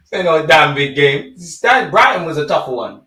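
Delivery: high derisive laugh into the words; scoffing laugh. Real, not acted. Fan mocking people calling it a big game, derisive laugh.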